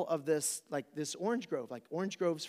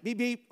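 car horn imitation; 7 semitones higher, 4 dB louder than usual. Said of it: "and I'm like".

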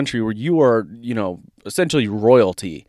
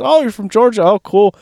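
amused cashier; 8 semitones higher, 4 dB louder than usual. Retold or imitated. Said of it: "She goes".

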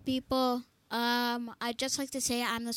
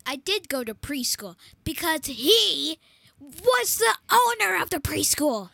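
insistent claim; 4 semitones higher, 9 dB louder than usual. Real, not acted. Kid insists on preschool story.

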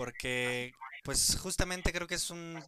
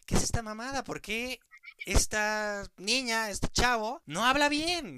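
annoyed complaint; 7 semitones higher than usual. Retold or imitated. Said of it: "les emputa y dicen".

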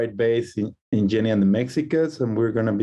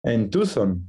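ordinary delivery

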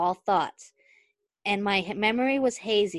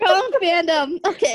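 laughing; 10 semitones higher, 7 dB louder than usual. Real, not acted. Echoes friend, laughing.

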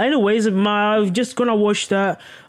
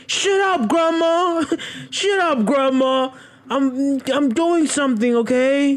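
much higher, hammed-up voice brushing grandma off; a whiny grandson telling grandma to shut up; mock annoyed grandson; 9 semitones higher than usual. Playing Dahmer telling his grandma to shut up, high whiny voice.

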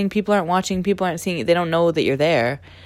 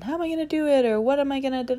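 high-pitched imitation of worried questions; mock fretting; 8 semitones higher, 4 dB quieter, faster than usual. Voicing a listener who finds it hard, mock fretting. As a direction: fret it up high, like an overwhelmed beginner.

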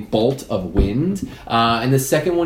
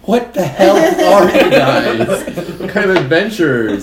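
higher, louder, slow mock outrage; exasperated outburst; 8 semitones higher, 7 dB louder than usual. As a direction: play the baffled, scandalized hero. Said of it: "And he says".

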